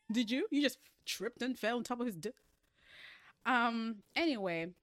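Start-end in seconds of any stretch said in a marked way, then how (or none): 0.0-2.3 high, loud teasing interrogation; mock disbelief. Host teasing the book's heroine, mock disbelief. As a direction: grill her in playful disbelief.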